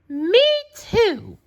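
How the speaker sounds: put-on very high, squeaky baby kangaroo; tiny kangaroo voice. A narrator's reading. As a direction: pipe up proudly like a little joey. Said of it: "the young kangaroo in her pouch said".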